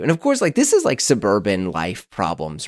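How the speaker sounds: ordinary talk, nothing marked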